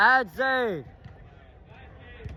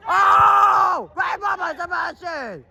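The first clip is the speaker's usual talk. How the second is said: excited shout; 8 semitones higher, slower than usual. Real, not acted. Praising a defensive play.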